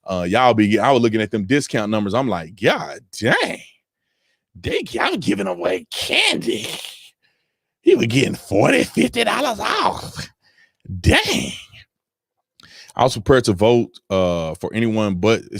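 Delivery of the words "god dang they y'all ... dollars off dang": very high, drawn-out amazed exclamation; hammed-up disbelief, pitch leaping up; mock astonishment. Shopper reacting to the discount numbers, mock astonished.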